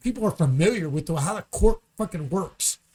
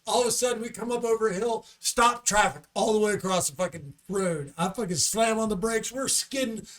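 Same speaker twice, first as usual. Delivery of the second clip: normal talking voice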